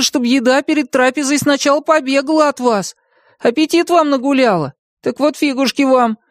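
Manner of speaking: high-pitched indignant tirade; defiant little hero. A narrator's reading. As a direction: challenge the dragon in a high, excited voice. Bartolomeo's defiant monologue to dragon.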